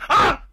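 short, high cry of fright; a sudden yelp of alarm; startled gasp. Acted. Waking man sees a leopard before him, startled yelp.

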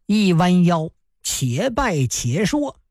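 ordinary delivery, nothing marked

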